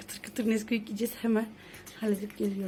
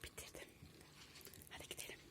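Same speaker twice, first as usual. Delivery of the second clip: whispering; 17 dB quieter than usual. Real, not acted. Sneaking to eat cereal silently.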